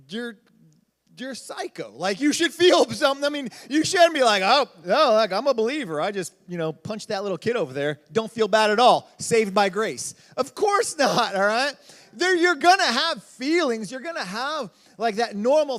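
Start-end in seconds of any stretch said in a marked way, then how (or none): 4.4-10.1 loud, hammed-up smug believer brushing it off; loud sarcastic impression of a believer who feels no guilt; mocking careless believer.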